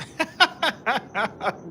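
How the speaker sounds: high burst of giggles; real laugh. Real, not acted. Streamer amused at I got a glimpse, high laughter.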